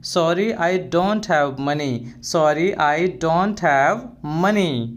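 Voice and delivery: slow, carefully spelled-out English phrase; slow and clear classroom model phrase; slow teaching demo. Shown as a demonstration. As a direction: say each word slowly for the learner. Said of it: "next".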